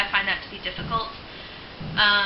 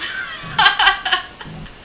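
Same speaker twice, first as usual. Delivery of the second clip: a sudden high burst of laughter; 4 dB louder than usual. Real, not acted. Woman laughing as she warns a predator, high burst. Laughs before threatening line.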